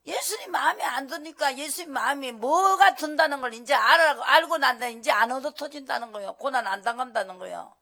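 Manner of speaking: loud, high sermon push; preaching at full force. Acted. Preacher warning the congregation, loud and high. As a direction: drive the point home loudly.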